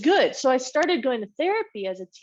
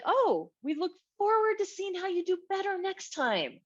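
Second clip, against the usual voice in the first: high, sweet mock-parent voice; imitating parents; 11 semitones higher than usual. Parents answering a child's project, high sweet mock voice. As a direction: put on a parent's gentle, high voice. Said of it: "And they'd be like".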